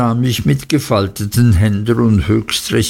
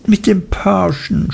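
high, lightly acted tearful voice; a touch of a sob, pitched well up; sobbing wife; 8 semitones higher than usual. A narrator's reading. Wife answers husband in quarrel, mildly sobbed. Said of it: "schluchzte Dora".

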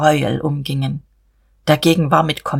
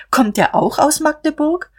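pointed question; 11 semitones higher than usual. Acted. Sister needling her brother about the groom, pointed high question. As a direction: question climbing far higher, sly disbelief. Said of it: "fragte Emmy".

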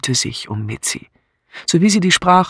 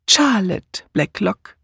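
slow, higher-pitched "Charlotte Blacklock", the first name set out clearly; correcting; 5 semitones higher, slower than usual. A narrator's reading. Miss Marple correcting the sergeant's name, "Charlotte" said slowly and pointedly. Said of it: "verbesserte Miss Marple ihn".

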